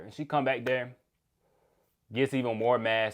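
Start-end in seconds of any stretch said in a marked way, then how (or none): none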